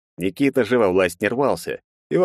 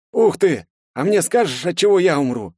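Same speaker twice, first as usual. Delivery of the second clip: eager tipsy excitement; excited; 10 semitones higher, 3 dB louder than usual. A narrator's reading. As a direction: ask it thrilled. Andrey excited about prediction.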